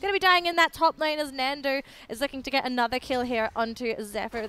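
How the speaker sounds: excited shoutcaster in full flow; live play-by-play. Real, not acted. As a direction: call the action loud and excited.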